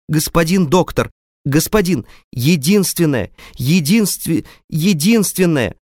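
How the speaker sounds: high, ringing youthful cries; a desperate father crying out to the doctor. A narrator's reading. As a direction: cry out in desperation, voice cracking. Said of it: "выкрикнул он вдруг по-юношески звонко".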